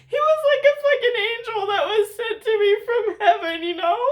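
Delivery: half laugh, half sob; laughing through tears. Real, not acted. Laughing and crying together.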